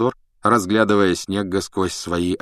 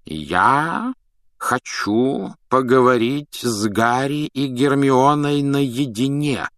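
slow, raised, deliberate delivery; insistent repetition; 5 semitones higher, much slower than usual. A narrator's reading. Dumbledore repeating his wish to see pupils alone, insistent. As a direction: spell it out slowly and insistently. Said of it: "повторил Дамблдор".

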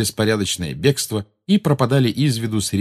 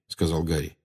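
usual speaking voice

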